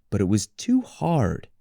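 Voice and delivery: plain reading voice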